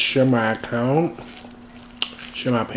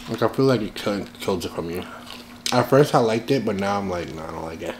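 eating while speaking; talking while chewing. Real, not acted. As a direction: mouth partly full of food. Seen 0: Lips pressed and moving, food held, chewing between words throughout.

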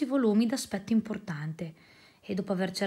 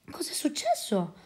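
whispered question; a soft breathy whisper of concern; hushed whisper; 5 semitones higher, 3 dB quieter than usual. Acted. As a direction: whisper it, worried not to disturb. Secretary asks in hushed worry.

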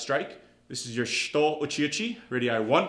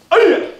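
high, very loud martial arts yell; explosive karate kiai; kiai shout; 14 dB louder than usual. Real, not acted. Instructor ending a kick drill, sharp kiai shout.

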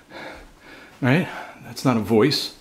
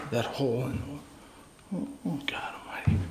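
scared whisper; 2 semitones higher, 11 dB quieter than usual. Real, not acted. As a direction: breathe the words out in fear. Frightened alone in dark house.

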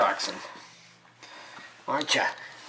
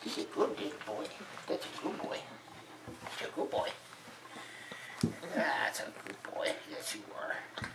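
breathy whispered dog praise; whispering; 11 dB quieter than usual. Real, not acted. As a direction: whisper sweetly to the pup.

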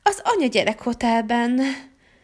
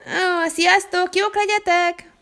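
drawn-out goodbye; 7 semitones higher, slower than usual. Acted. Mom waving goodbye to kids.

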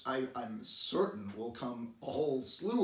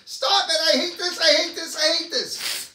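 much higher, much louder frustrated screaming; screaming at the computer; 14 dB louder than usual. Retold or imitated. Frustrated at a stubborn computer, screaming at it. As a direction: lose it completely at the machine. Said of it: "start screaming at the computer".

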